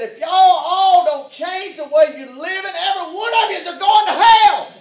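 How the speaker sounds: full-throated, very high angry yelling; furious shout. Retold or imitated. Preacher re-enacting his condemnation of the mechanics, furious shouting. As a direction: bellow a re-enacted condemnation at top volume. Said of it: "I said it with anger in my heart".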